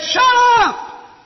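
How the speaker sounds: explosive high-pitched angry yell; furious acted outburst at full volume; livid shout. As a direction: bellow it like a man who has lost his temper. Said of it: "became livid".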